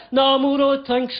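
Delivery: declining in imitated Birmingham; Birmingham accent. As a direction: Birmingham flatmate turning down an offer. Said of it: "in his Birmingham accent".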